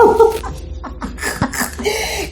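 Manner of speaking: airy embarrassed laugh; high unvoiced giggle; breathy laugh. Real, not acted. Laughing at own outburst.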